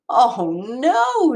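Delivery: high, rising mock wail on no; a child's dismayed wail; retold wail of disappointment. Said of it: "she wailed".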